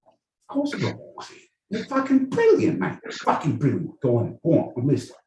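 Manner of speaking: high-pitched hammed-up Aussie; Aussie accent. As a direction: gush in a put-on Australian accent, pitched high. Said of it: "you always go Aussie".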